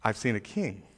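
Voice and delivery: low, soft and unhurried; calm God. Retold or imitated. Preacher voicing God calmly reassuring Samuel, low and soft. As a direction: reassure them quietly, nothing's wrong. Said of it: "He says".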